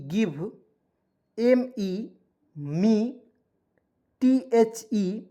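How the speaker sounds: usual speaking voice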